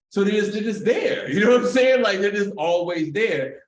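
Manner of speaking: loud, high, laughing disbelief. Real, not acted. Telling a friend cops are everywhere, laughing loudly. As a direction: laugh it out, amazed.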